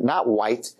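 normal talking voice

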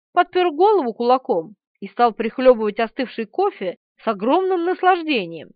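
usual speaking voice

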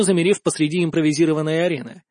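usual speaking voice

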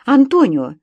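loud, high-pitched demand without a greeting; crying out. A narrator's reading. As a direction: cry out the question in alarm. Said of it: "закричала я".

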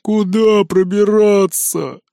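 slow whiny piglet voice; whining. A narrator's reading. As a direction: drag it out in a whine. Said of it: "заныл Жорик".

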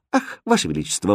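ordinary delivery, nothing marked

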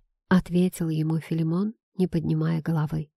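usual speaking voice

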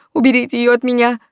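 loud, high panicked wail; wailing plea. A narrator's reading. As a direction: beg them to get it off. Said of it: "причитал граф".

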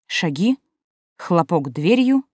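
usual speaking voice

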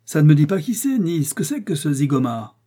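loud, high protest, leaping in; exasperated outburst. A narrator's reading. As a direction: object hotly to the non-answer. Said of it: "Thornton s'est élevé d'un bond". (protestation vive, bondissante)

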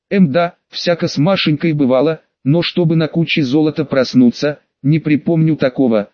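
usual speaking voice

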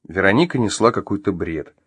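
plain reading voice

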